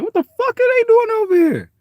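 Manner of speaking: very high, loud squeal of disbelief; startled kid. Retold or imitated. Kids in the neighborhood spotting an outsider, very high and loud. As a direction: shriek it like a kid seeing strangers. His younger self reacting.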